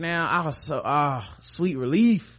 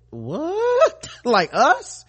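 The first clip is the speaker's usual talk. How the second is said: squeaky, high in disbelief; shocked disbelief; 13 semitones higher, 4 dB louder, much slower than usual. Retold or imitated. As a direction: gasp it like you can't believe it. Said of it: "I was like".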